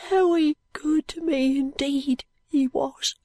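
Very said with a clear V here; high, slow boy says Very good; dying boy voice. A narrator's reading.

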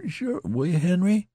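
high, pleading question; beseeching. A narrator's reading. Wounded soldier begging his friend, beseeching. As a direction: beg for reassurance, pitched high. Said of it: "tall soldier beseeched".

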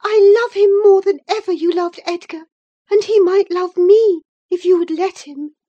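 very high, loud outburst of love; infatuated, defiant Isabella. Acted.

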